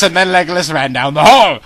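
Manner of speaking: ordinary delivery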